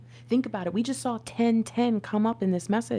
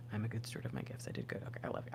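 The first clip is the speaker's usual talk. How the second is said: softer, hushed repeat of the affirmation; 7 semitones lower, 12 dB quieter, much faster than usual. Real, not acted. Reader affirming herself to viewers, hushed. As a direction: say it quietly to yourself.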